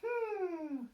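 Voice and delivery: high little-pig hum; a thoughtful high hum as Peppa; Peppa thinking. A narrator's reading. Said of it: "thinks Peppa".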